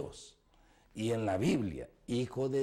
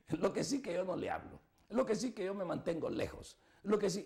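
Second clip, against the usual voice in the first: fast, high mock protest; hint of a whiny excuse; mocking excuse-maker; 5 semitones higher, 5 dB quieter, faster than usual. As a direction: imitate someone making excuses. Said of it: "hay quienes dicen".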